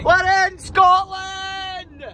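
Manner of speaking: high, long-drawn celebratory yell; triumphant shout. Real, not acted. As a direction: shout the arrival, stretching it out. Announces crossing the border.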